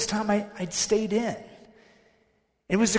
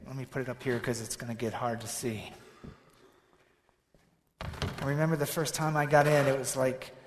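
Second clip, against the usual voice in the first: ordinary talk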